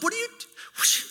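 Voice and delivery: squeaky high panicked yelp, rushed; a comic freakout at the delivery; mock panic. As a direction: blurt it out in a frantic squeal. Panicked dad at the birth.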